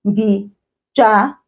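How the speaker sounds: "Ngijabule" split into separate syllables. Shown as a demonstration. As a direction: pause after each syllable of "Ngijabule". Teacher breaks word into syllables.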